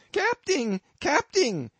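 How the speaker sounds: very high, loud squeal; high-pitched alarmed holler; panicked bumpkin yell. A narrator's reading. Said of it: "yelled the bumpkin".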